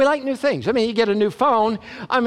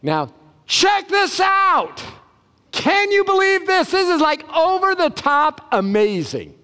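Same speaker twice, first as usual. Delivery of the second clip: wide-eyed wonder, way up high; amazed exclamation; 8 semitones higher than usual. Shown as a demonstration. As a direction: gasp it out like you can't believe it. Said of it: "that's like saying".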